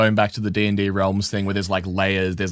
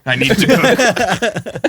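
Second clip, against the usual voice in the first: drawn-out, higher mock version; slow, hammed-up echo; 9 semitones higher, 5 dB louder, much slower than usual. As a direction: stretch it out comically slow. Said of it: "echoing Alex's line".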